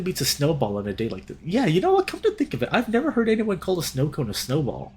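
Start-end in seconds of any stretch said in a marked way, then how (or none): none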